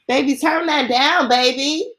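loud, high call to turn it down. Real, not acted. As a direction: shout across the room, real. Calling someone to lower the volume.

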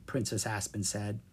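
plain reading voice

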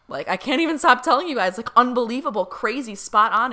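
can't hold it in, rush the praise out; gushing excitement. Real, not acted. Raving to viewers about the reading, breathless excitement.

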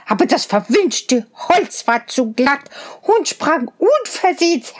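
hohe, zänkische Zwergenstimme; zänkischer Zwerg. A narrator's reading. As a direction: giftig schimpfen wie ein Zwerg. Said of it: "antwortete der Zwerg". (Zwerg beschimpft Rosenrot, hoch und zänkisch)

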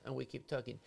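ordinary delivery

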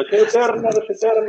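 high, overexcited Arnold voice; Schwarzenegger. Retold or imitated. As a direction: chant it like Arnold shopping. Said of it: "así como Schwarzenegger comprando".

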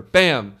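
a sudden loud mock crash noise; imitated crash bang. Retelling the movie crash to friends, loud mock bang. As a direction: punch out a loud crash sound.

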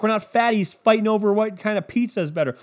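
normal talking voice

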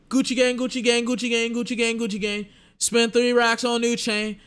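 hammed-up [name] hook rapped high; imitating [name]. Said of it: "he still does the [name] where he says his own name".

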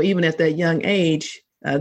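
ordinary talk, nothing marked

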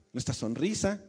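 usual speaking voice

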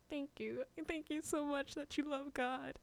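high, soft mock-weepy thanks; tearful gratitude. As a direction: imitate a crying child's grateful relief. Imitates tearful younger self thanking sister.

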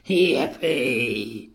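low-set, put-on cheer; understated storybook whoop; villainous cheer. A narrator's reading. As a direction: cheer in a character voice. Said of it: "cried out".

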